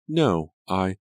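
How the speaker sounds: high, loud alarmed cry; a startled, alarmed "Look!". A narrator's reading. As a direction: cry out to point at him. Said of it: "Angelica cried".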